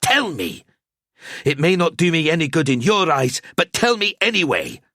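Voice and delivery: high, loud shout; furious demand. A narrator's reading. Gruber confronts the old alchemist, loud and furious. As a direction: demand it in his face.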